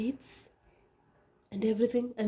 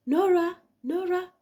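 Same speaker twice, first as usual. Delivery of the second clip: higher, loud call of the name; 8 semitones higher, 3 dB louder than usual. Retold or imitated. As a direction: call her back as she drifts off. Surgeon calling patient out.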